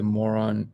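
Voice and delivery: "moron" said in place of Monroe; misreading. Real, not acted. Reading a viewer's message, accidental misreading as "moron". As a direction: say "moron" with MOR first, then on.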